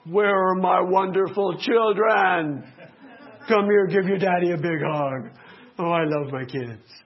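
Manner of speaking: slow, loud, beaming father calling out; hammed-up loving daddy; fond dad greeting. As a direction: greet the kids like a happy dad. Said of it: "I wanna come home and go".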